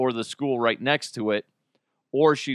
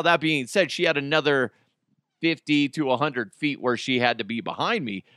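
ordinary delivery

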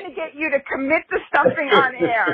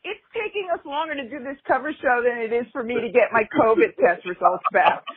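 ordinary talk

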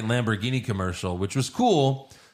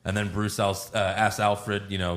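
ordinary delivery

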